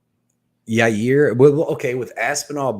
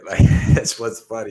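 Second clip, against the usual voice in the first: normal talking voice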